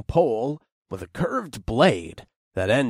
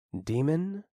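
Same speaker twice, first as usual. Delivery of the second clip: whispered; 5 semitones higher, 7 dB quieter than usual. Acted. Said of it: "I whispered".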